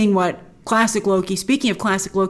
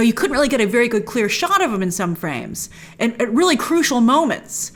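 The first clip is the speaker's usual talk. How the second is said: normal talking voice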